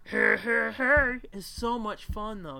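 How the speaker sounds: hey hey hey, Fat Albert style; a touch of Fat Albert's greeting. Retold or imitated. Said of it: "Fat Albert".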